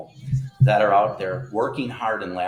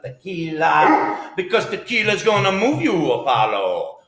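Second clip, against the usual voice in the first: excited; 8 semitones higher, 8 dB louder than usual. Real, not acted. Toast to tequila.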